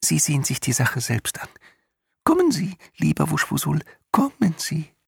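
high, chirpy tiny voice; sing-song chirpy invitation; chirping voice. A narrator's reading. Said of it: "zwitscherte der Winzling".

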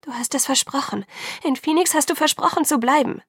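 reproachful and imploring; pleading reproach. A narrator's reading. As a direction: hold him to his promise, voice climbing. Bella reproaches Edward, pleading.

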